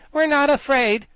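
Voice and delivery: put-on high, loud defiant girl general; determined. Ginger answers the guardian, high determined defiance. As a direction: defy him proudly. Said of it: "she looked so determined".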